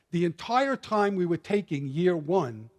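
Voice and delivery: ordinary talk, nothing marked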